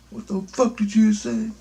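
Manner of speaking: angry guy. Retold or imitated. A stranger offended by his insult, high mock challenge. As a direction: squawk it like a riled-up stranger. Angry man confronting him.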